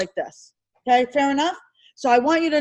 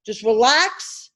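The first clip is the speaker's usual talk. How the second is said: slow calming; 5 semitones lower, much slower than usual. Shown as a demonstration. Teacher calming nervous exam students, slow and soothing. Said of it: "don't panic".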